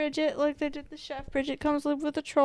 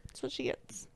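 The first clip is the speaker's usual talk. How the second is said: softly whispered, no voice; 11 dB quieter than usual. Real, not acted. Friend whispers to friend about the dead chef, whispering. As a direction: breathe it out as a gleeful aside.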